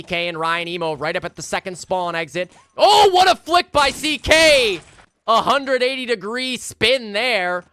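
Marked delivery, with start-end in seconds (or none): 2.8-4.8 screamed hype call; excited shout. Real, not acted. Caster hyping a big flick. 5.3-7.6 excited. Real, not acted. Caster hyping the flick.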